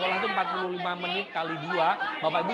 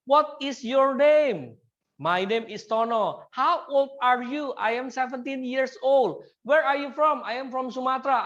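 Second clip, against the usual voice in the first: slow, higher, louder question-and-answer drill; classroom drill; 5 semitones higher, 3 dB louder, slower than usual. Shown as a demonstration. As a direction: model each line for students to repeat. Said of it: "Tutor melafalkan kalimat pertanyaan dan siswa menirukan".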